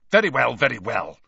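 loud irritated snap; snapping. A narrator's reading. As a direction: bite the words off impatiently. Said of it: "Snape snapped".

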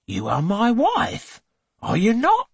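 he presses her for agreement; insistent husband. A narrator's reading.

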